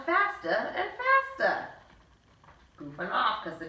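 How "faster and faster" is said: much higher, slow; rising excitement. A narrator's reading. A boy spinning faster, voice climbing much higher. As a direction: climb up with the spin.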